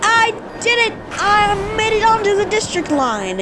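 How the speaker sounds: high, excited "I did it"; real gamer joy at clearing the level; triumphant cheer. Real, not acted. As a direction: celebrate the win with a burst.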